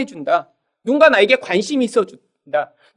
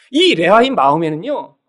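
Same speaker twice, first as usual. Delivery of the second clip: ordinary talk, nothing marked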